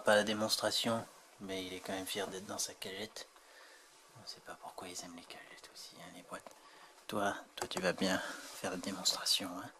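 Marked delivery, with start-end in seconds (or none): 4.2-6.5 soft whispered aside; whispering. Real, not acted. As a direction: whisper it confidentially. Aside about cats liking boxes.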